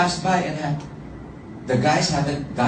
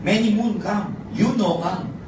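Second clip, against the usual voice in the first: broken English reply; 7 semitones higher than usual. Retold or imitated. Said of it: "my reply is the same".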